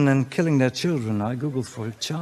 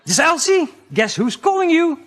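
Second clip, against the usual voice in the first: gloating phone voice; 13 semitones higher, 9 dB louder than usual. Acted.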